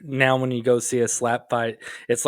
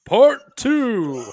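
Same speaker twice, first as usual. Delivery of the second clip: higher booming announcer call; fighting game announcer; 9 semitones higher than usual. Pretend. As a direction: stretch it like a ring announcer. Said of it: "Do you need me to do the announcer voice thing?".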